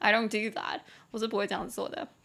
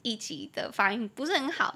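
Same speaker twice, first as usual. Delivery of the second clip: normal talking voice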